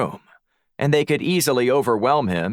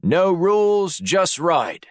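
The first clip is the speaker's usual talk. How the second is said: put-on breezy, higher-pitched Aussie accent; Australian accent; 9 semitones higher, slower than usual. A narrator's reading. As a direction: toss it out like an Australian motto. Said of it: "summoned his best Australian accent".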